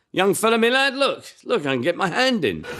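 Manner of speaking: high, leering tailor voice; over-familiar tailor. Acted. Tailor groping a young man in the changing room, high and leering. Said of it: "over-familiarity in the changing room".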